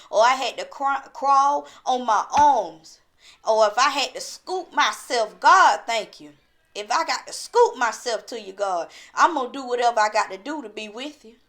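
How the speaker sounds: fervent retold plea, punching key words; impassioned appeal to God. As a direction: preach the prayer with mounting resolve. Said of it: "you got to talk to God like that".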